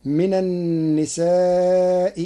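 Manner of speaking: slow, held aa in النساء; long madd in النساء. Shown as a demonstration. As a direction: stretch النساء in recitation. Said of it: "بتسهيل الهمزة الثانية".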